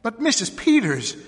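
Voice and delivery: high, loud urgent appeal; alarmed cry. A narrator's reading. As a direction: cry out to her, aghast. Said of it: "cried Missus Hale".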